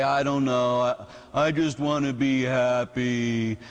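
lightly voiced spacey kid, slow and low; drawn-out, low mock musing. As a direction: imitate a kid musing slowly. Imitates drunk kids musing on life.

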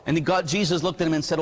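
ordinary delivery, nothing marked

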